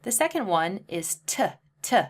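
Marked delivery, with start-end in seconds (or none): none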